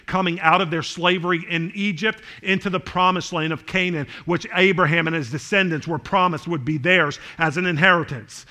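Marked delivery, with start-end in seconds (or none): none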